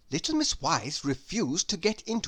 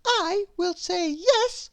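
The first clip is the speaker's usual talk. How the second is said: high and louder, firmly decided; high resolute voice; 14 semitones higher, 4 dB louder than usual. A narrator's reading. Little Miss Wise announcing her decision, high and determined. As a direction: declare it like a brave little vow.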